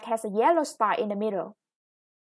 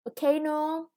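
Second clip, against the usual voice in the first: Volcano with the second syllable, cay, stressed; 4 semitones higher than usual. Shown as a demonstration. Teacher shows the class where Volcano is stressed. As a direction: lean on the middle syllable of Volcano. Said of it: "the stress is on the second syllable".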